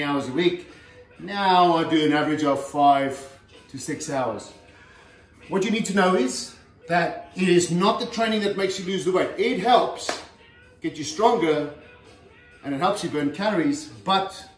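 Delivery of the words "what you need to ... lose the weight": ordinary talk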